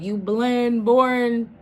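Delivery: gleeful copy of her clapback; sassy quote. Retold or imitated. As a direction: relish repeating her insult. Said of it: "She said".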